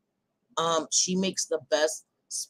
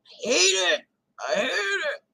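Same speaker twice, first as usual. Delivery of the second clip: high whining I hate it; whiny put-on voice; 9 semitones higher, slower than usual. Streamer whining her disgust at cheesesteaks in a high voice. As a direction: whine it like a fussy child. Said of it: "Mm, no".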